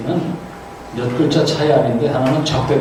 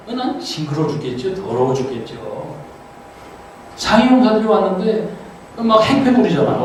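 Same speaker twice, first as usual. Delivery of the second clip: normal talking voice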